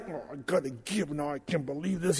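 grudging giver. Retold or imitated. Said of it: "it's like".

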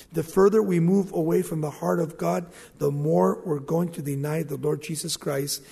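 ordinary delivery

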